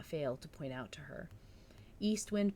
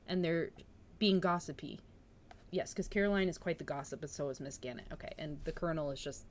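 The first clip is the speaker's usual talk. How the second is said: usual speaking voice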